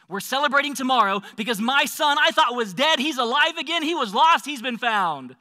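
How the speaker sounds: jubilant proclamation. Acted. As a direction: proclaim it for the whole village to hear. Father proclaims the son's return.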